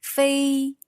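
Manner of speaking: "非" held high and level; first tone. Shown as a demonstration. Teacher repeating for the learner, "非" high and level. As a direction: sustain "非" on a high flat pitch. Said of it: "the first one is the high one".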